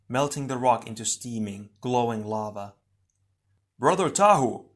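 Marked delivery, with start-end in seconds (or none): 3.8-4.7 higher, louder shout to a comrade; urgent shout across a distance; distant call. A narrator's reading. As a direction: call out as if from far off. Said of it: "Onua's voice sounded distant".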